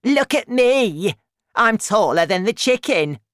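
grandma shouting. A narrator's reading. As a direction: shout down from the roof for attention. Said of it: "Grandma shouted from the roof".

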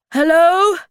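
high, very loud call; shouting. A narrator's reading. Moomin Papa trapped in his room, loud calling shout. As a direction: holler to be heard through the house. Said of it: "shouted Moomin Papa again".

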